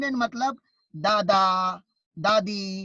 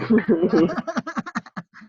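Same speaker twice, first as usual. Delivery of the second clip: real loud laugh; laughter; 5 dB louder than usual. Real, not acted. Teacher amused at a student's likely wrong answer, laughing.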